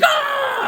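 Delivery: sudden high yelp, hammed-up; mock scream of pain. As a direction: yelp like a bone just snapped. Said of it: "And I'm like".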